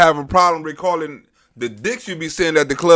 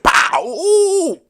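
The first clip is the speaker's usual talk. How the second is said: imitated crash; 12 semitones higher than usual.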